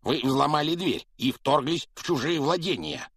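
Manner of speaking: high, loud outraged protest; shrill-edged indignation of a frightened man; indignant uncle. A narrator's reading.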